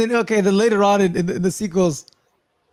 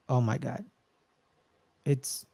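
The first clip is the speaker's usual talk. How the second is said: a hushed oh my God, much softer; hushed dread; 4 semitones lower, 10 dB quieter than usual. Retold or imitated. Fan reliving the tense moment, hushed and awed. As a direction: breathe it out in quiet dread.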